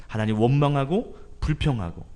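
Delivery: ordinary talk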